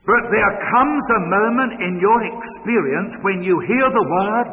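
high, loud ringing preacher's voice; fervent sermon climax; preaching at full force. Acted. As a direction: build to the call.

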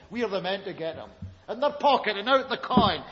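ordinary talk

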